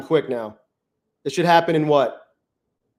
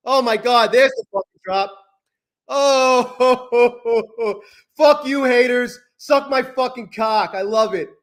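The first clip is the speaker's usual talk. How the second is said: gloating; 6 semitones higher, slower than usual. Real, not acted. Gloating at haters over a win.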